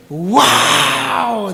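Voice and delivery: high, loud cry of amazement; amazed wow. Acted. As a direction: gasp it out in wonder. Animals amazed at flying frog. (asombro fuerte)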